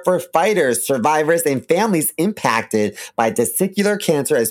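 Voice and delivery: upbeat sponsor ad read. Acted. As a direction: sell it like a radio spot. Reads a sponsor ad.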